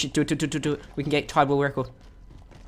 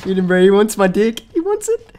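excited taunting; 7 semitones higher, 9 dB louder than usual. Real, not acted. Taunting an enemy player mid-fight.

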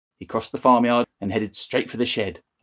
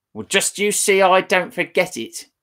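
put-on high, loud bossy old woman; nagging grandma calling out; screechy grandma; 9 semitones higher, 4 dB louder than usual. Grandma calls from next room.

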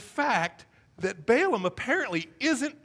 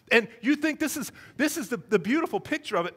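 ordinary talk

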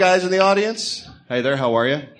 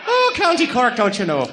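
high, hammed-up Irish lilt, mock stage Irish; mock Irish accent; 6 semitones higher than usual. Host teasing Irish guest in mock Irish accent. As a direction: tease her with a cheesy Irish brogue. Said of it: "They don't talk like that".

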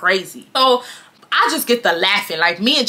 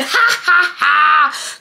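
high, spaced-out fake "ha, ha, ha"; mock laugh; 5 semitones higher, much slower than usual. Laughing at the angry girl on purpose, mock laugh. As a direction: force out each ha tauntingly. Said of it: "And I said".

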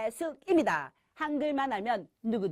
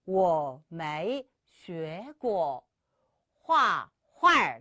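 very slow, tones drawn out; slow teaching read; slow model sentence; 3 semitones lower, much slower than usual. Shown as a demonstration. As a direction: model each syllable slowly. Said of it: "시작!".

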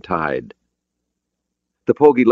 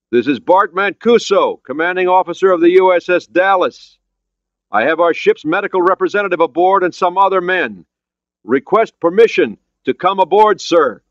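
raised, high official announcement; formal shouted hail; 13 semitones higher, 5 dB louder than usual. Acted. Commander requesting permission to come aboard, formally shouting. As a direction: call out name and request across water.

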